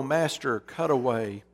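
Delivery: ordinary talk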